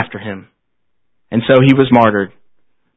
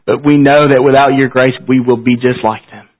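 prayer. Acted. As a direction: pray humbly to close the service. Closing prayer after "Let's pray.

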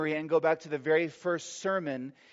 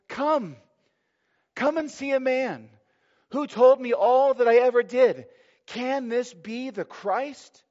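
much higher and louder, eager call; excited woman calling; 7 semitones higher, 5 dB louder than usual. Acted. Samaritan woman calling the townspeople, excited and high. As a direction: call the town to come see, thrilled.

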